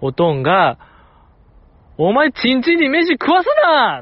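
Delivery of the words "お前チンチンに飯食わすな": high, loud imitated shout at dinner; dad yelling. Dad shouting at mom over dropped rice, imitated loud yell. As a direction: bellow it like an outraged father. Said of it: "めっちゃ大声で言ってた".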